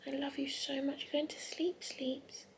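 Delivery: hushed, tender whisper. Real, not acted. Mum lulling her newborn daughter to sleep, soft whisper.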